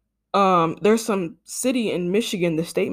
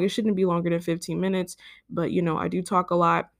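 ordinary talk, nothing marked

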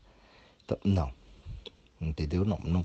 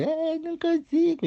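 squeaky high excuse; mock the client giving up, pitched way up; whiny client; 4 dB louder than usual. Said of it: "o que eu falei pra você?".

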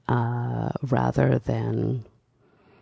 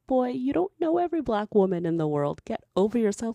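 high, sassy put-down, pitched way up; sassy retort; 10 semitones higher, faster than usual. Retold or imitated. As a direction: tell him off with high-pitched sass. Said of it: "I'm like".

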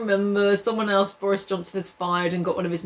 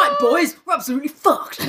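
mock Ralph; 5 semitones higher, 4 dB louder than usual. Voicing Ralph addressing boys.

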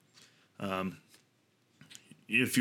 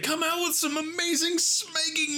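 high, squealing gush of excitement; excited squeal; slower than usual. Real, not acted. Vlogger squealing to viewers over amazing game news, overjoyed. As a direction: squeal it like you can't contain the thrill.

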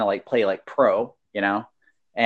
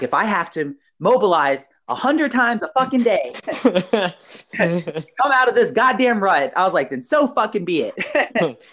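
mock pep talk; 7 semitones higher than usual. Telling himself to snap out of a rut, mock pep talk. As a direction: rev yourself up, dragging each word out.

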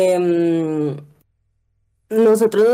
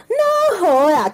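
startled exclamation; 9 semitones higher, much slower than usual. Retold or imitated. Both flee in fright.

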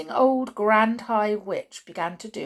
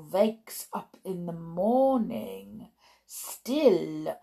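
put-on measured, menacing step-by-step explanation in accent; 3 dB louder than usual.

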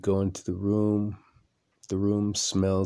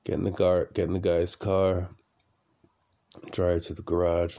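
usual speaking voice

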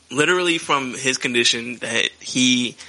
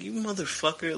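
much softer disgusted aside; under the breath; 5 semitones higher, 11 dB quieter than usual. Retold or imitated. A fan's quiet fed-up response to the dull commentary. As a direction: drop it to almost nothing, fed up. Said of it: "And I'm like".

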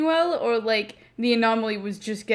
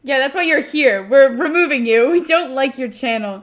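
mock taunt; 4 semitones higher, 9 dB louder than usual. Mock bullying of guest.

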